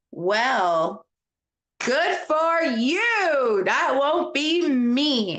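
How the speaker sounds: high, slow, drawn-out singsong; playful mock congratulation. Real, not acted. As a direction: stretch it out way up high, teasing. Teasing a viewer who is flying.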